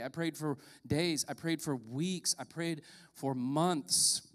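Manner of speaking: slowing repetition. Acted. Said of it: "repetition slowing for emphasis".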